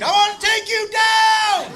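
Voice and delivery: high, drawn-out mock war yell; screamed battle cry. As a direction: yell it like a charging fighter. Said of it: "and he screamed".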